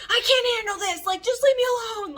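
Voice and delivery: high, loud snapping at him; imitating her own angry snap; super snappy. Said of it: "I'm like, super snappy".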